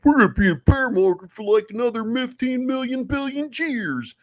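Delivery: high, loud whiny mockery of bears; mocking doomer voice. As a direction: squeal it like a panicked crypto bear. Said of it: "raging psychos saying".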